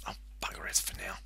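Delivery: a hushed, quick whisper under the breath; whispered aside. Real, not acted. Player grumbling to himself at the game, whispered. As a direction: let the frustration slip out in a whisper. Muttered aside while playing.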